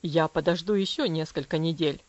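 low, firm father's voice; measured warning from above; stern father. Acted. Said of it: "сказал он". (низким строгим голосом отца)

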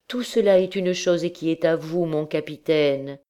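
fervent, plaintive declaration of love; impassioned pleading. Acted. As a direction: offer yourself completely, voice lifted and imploring. Girl desperately declares love to captain.

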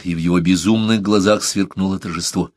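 ordinary delivery

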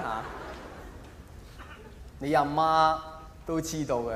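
normal talking voice

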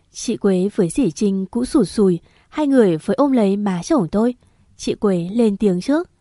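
ordinary delivery

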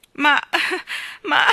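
catching a sob between words; halting, sob-broken start. A narrator's reading. Janet tries to go on, with a sob. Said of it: "continuò Janet con un singulto".

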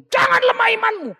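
mocking a preacher yelling from the pulpit; preacher's shout. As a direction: shout it like a preacher scolding the flock. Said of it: "Terus kau teriak di sini".

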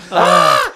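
loud, high mimicked scream; imitated scream. Man imitating the girl drenched in cold water, screaming. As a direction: let out a sudden mock shriek. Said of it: "She screams".

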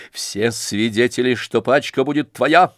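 usual speaking voice

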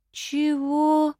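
a high, stunned "Чего?"; "Чего?" blurted high, caught off guard; taken aback. A narrator's reading. Heroine stunned by the sacrifice idea, high and startled. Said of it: "опешила я".

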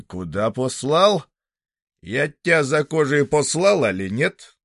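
нарастающий грубый окрик, выше и громче; отчитай ученика всё злее; грубый хозяин. Acted. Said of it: "спрятав руки за спину". (хозяин распекает сироту, грубо и громко)

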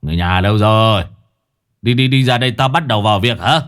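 loud call to the household; bossy shout across the house. A narrator's reading. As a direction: holler to summon everyone, rising in volume. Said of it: "rồi gọi".

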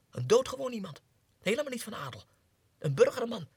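high, loud, eager; excited rant. Acted. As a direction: build up the wild idea. Excited revelation. (opgewonden betoog)

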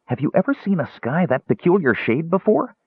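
high, wondering question; excited. A narrator's reading. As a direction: ask it in eager amazement. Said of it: "he called in excitement".

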